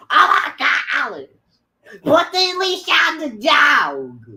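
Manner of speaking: loud, high scolding yell; mom yelling. Retold or imitated. Mother yelling at daughter over the dog leash, loud and high. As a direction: yell it at your kid like an impatient mom. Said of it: "That's how I sound when I yell".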